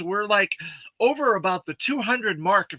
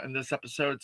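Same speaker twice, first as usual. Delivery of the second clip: ordinary talk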